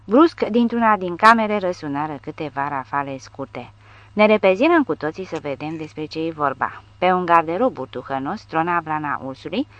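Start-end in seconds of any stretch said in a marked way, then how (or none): none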